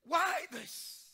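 a sudden cracked cry to God. Retold or imitated. Father retelling his prayer to God, crying out. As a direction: cry it out as he did that day. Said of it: "I began to cry".

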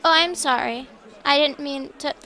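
ordinary talk, nothing marked

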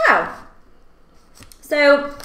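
ordinary talk, nothing marked

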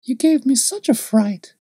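put-on high gleeful child's voice; little girl. A narrator's reading. Little girl chatters to the man, high child voice.

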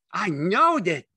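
high, loud exclamation; crying out. A narrator's reading. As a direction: burst out eagerly. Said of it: "Lenny cried".

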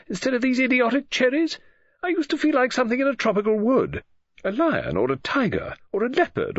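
high, fluttery complaint about the wallpaper; a restless lady novelist grumbling, pitched high; Mrs Oliver's restless musing. A narrator's reading. Mrs Oliver grumbling to herself, high and restless.

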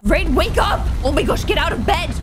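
urgent calling out. Real, not acted. Player urging Rain out of bed, urgent calling. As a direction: call out to rouse a sleeper. Calls game character to wake.

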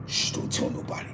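whispered shush to his daughter; secretive hush. Retold or imitated. Dad shushing daughter about secret.

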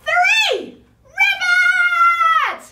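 high shouted frog call; super duper loud. Shown as a demonstration. Teacher leading children's loud ribbit, big shout. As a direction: shout it out, stretching the last sound. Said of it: "your super duper loud ribbit".

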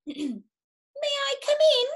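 high, slow and polite little cat voice. Acted. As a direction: ask sweetly as the polite cat. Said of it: "the cat asked politely".

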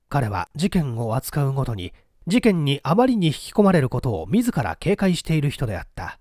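ordinary delivery, nothing marked

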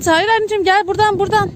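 high-pitched coaxing correction; urgent redirection. Real, not acted. Parent guiding a child to the right climbing spot, high coaxing correction.

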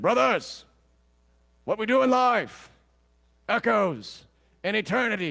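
loud, high British battle speech; rally the troops in a booming British accent; British-accented general. Acted. General rallying his cavalry before battle, loud British accent. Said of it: "in his British accent".

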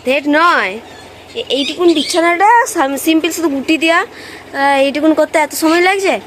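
loud, high-pitched scolding of her husband; wife loudly scolding husband for taking so long. Real, not acted. Scolding husband making the bed.

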